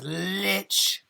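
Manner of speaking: a hint of a raspy voice, muffled; lightly voiced muffled rasp. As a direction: rasp the word from inside the bag. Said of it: "he says".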